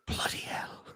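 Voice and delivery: whispered British oath; hushed, playful British exclamation; mock British accent. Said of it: "now talk like a British person".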